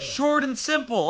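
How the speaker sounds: high, slow sneering repeat; mocking echo. Real, not acted. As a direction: throw the friend's words back scornfully. Mocks friend's words back.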